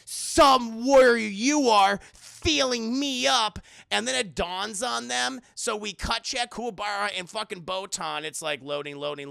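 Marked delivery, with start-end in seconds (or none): 0.1-3.6 slow, loud mock fury; irate. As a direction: punch out each word in hammed-up outrage. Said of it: "Miyuki is just irate".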